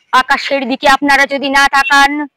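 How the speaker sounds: ordinary talk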